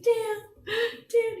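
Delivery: reliving the sobbing plea to a friend; crying out his name. Retold or imitated. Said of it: "But I did cry a little".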